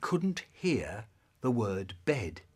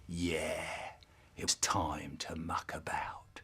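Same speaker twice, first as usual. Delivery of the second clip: whisper; 8 semitones lower, 9 dB quieter, slower than usual. Retold or imitated. Kids plotting mischief.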